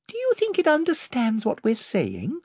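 put-on high wondering girl; young girl voice. A narrator's reading. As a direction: ask in a girl's voice. Lucy voiced in the story.

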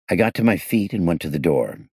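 usual speaking voice

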